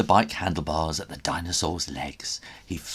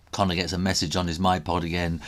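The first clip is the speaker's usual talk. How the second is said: usual speaking voice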